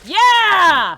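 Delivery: loud, high-pitched cheer; excited shout. Real, not acted. Reacting to a turn in the game story, shouted cheer. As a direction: shout it out in triumph.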